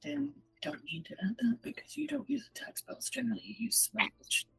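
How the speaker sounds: soft unvoiced whisper. Real, not acted. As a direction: lean in and whisper the rules. Whispering at the table.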